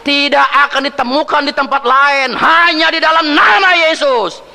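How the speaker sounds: high, loud, soaring proclamation; preaching at full force. Acted. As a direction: lift the congregation with Jesus' name. Khotbah memuncak. (khotbah berapi-api, tinggi dan keras)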